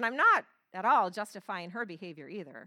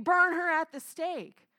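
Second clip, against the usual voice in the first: loud, stern hellfire pronouncement; mock fire-and-brimstone preacher; condemning pulpit preacher; 3 semitones higher, 7 dB louder than usual. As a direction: thunder judgment from the pulpit. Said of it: "he's in the pulpit saying".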